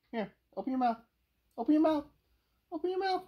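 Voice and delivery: high coaxing voice for a dog; coaxing pet voice. Real, not acted. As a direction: sweet-talk the dog into it. Coaxing dog to open mouth.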